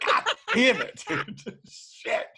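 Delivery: high, squeaky exasperated voice with laughter; squeaky mock frustration after a flop; frustrated comic. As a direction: whine it like a bombed comic. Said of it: "she's like".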